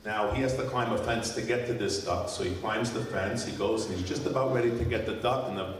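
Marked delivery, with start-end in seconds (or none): none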